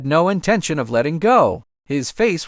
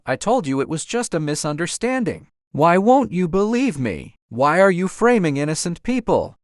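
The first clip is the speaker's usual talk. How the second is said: ordinary delivery, nothing marked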